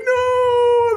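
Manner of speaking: very high, loud squealed wail; mock crying wail. Creatures about to be eaten, mock crying. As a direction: scream it as panicking little creatures. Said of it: "They were all crying".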